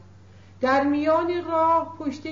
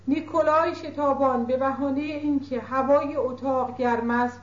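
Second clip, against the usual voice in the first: ordinary delivery, nothing marked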